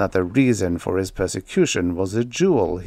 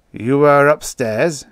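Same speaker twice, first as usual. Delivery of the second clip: slow, loud, higher-pitched demand; growled; 5 semitones higher, 6 dB louder, slower than usual. A narrator's reading. Tusman questions his pale servant, growling. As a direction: growl the question slowly and forcefully. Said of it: "growled Tusman".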